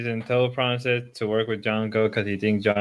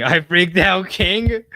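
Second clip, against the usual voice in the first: laughing, louder and higher; laughing echo; 7 semitones higher, 7 dB louder, slower than usual. Real, not acted. Streamer laughing back at a friend, louder and higher. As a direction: toss it back through a laugh. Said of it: "I think you freaked out".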